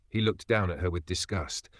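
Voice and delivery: usual speaking voice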